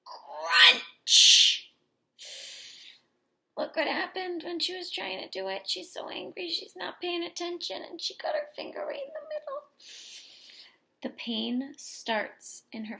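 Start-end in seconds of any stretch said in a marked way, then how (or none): none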